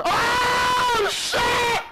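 high, loud panicked scream; scared scream. Real, not acted. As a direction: scream in sudden terror. Monster appears, streamer screams.

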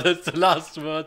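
ordinary talk

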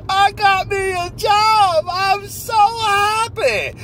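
high, slow, giddy voice of a man who just got a job; overjoyed new hire. Acted. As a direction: squeal it out like the happiest man alive. Sketch, interviewer vs job character.

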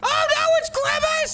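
high squeal of fake fear; high frightened squeal at Grimace; mock fright. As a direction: shriek at the monster. Sees the monster.

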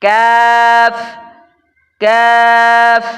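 কাফ long and loud, a front k; Arabic kaf. Shown as a demonstration. Teacher modelling kaf for students, loud and slow. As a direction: draw out কাফ loudly with a lighter k. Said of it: "উচ্চারণ হয়".

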